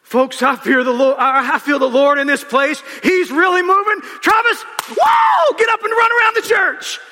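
high, loud holy-roller preaching; imitating grandma calling from the pulpit. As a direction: shout it like a fiery Pentecostal preacher grandma. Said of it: "she goes".

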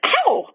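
high-pitched sudden "ow"; pained yelp. A narrator's reading. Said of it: "made a noise like".

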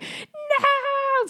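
high panicked cry; mock panicked no; freaking out. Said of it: "freaking out".

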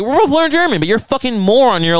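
high, exasperated rant; pissed off. Real, not acted. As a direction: spell it out, fed up with your partner. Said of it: "getting so pissed off".

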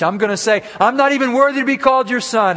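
high, loud plea of unworthiness; rehearsed confession. Acted. Prodigal son practising his speech for his father, high earnest confession. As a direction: rehearse an apology with rising intensity. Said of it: "He's rehearsing the speech".

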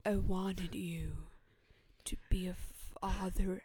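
lightly voiced pleading son, partly whispered; slow, breathy plea to dad; a hint of a hurt son, half-whispered; showing how it's done. Shown as a demonstration. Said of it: "It's like this".

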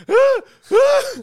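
two high, loud mock yelps; pained cries. Man getting his shoulder bitten, imitated high cries of pain.

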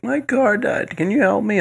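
put-on high, frail old lady voice; a sweet helpless old lady asking. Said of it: "some old lady was like".

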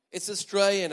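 ordinary talk

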